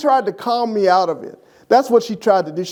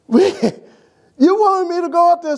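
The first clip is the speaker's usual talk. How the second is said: incredulous; 10 semitones higher, slower than usual. Retold or imitated. Incredulous at wife.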